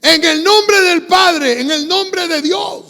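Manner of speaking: high, drawn-out, loud mock invocation; demon praying. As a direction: intone it slowly and loudly as a praying demon. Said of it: "cómo ora el demonio". (demonio orando a gritos)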